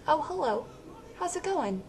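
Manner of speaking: Logan's prim greeting, pitched well up and hushed; stiff polite greeting. Retold or imitated. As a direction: imitate an oddly proper guy saying hello. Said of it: "was like".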